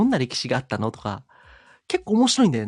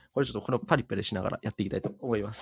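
paper rustling; 6 semitones lower, 6 dB quieter, faster than usual. Real, not acted. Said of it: "ペーパーノイズがめっちゃ入ってる".

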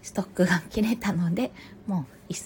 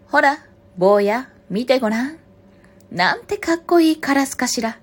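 raised, higher mother fox praise; deliberately loud voice; 6 semitones higher, 11 dB louder than usual. A narrator's reading. Mother fox flattering the crow to her child, deliberately loud. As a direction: say it loudly so the crow overhears. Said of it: "今度はわざと大きな声で".

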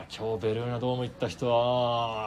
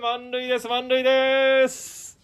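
excited announcing; 12 semitones higher, 4 dB louder, much slower than usual. Real, not acted. Announcing bases loaded.

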